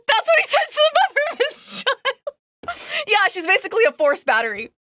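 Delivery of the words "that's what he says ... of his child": high, laughing through the words in disbelief; can't believe he said it, cracking up. Real, not acted. Laughs at his line, pitch jumps.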